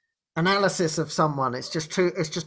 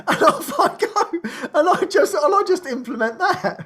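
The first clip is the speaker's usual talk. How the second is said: laughing; 8 semitones higher than usual. Real, not acted. Laughing at own naive start.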